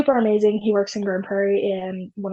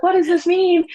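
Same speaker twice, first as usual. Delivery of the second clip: high-pitched mock-baffled question; retold bewildered asking; puzzled husband imitation; 8 semitones higher than usual. Acting her puzzled husband.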